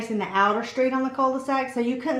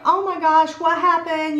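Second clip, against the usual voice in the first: imitated concern, higher and louder; alarmed husband; 6 semitones higher, 5 dB louder than usual. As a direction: blurt out mock alarm. Husband thinks she fell.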